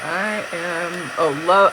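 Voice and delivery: normal talking voice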